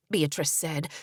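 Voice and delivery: plain reading voice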